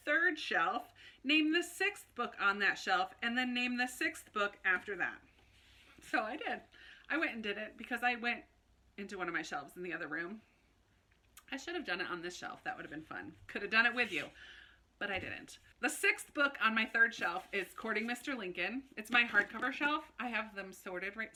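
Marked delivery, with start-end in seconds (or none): none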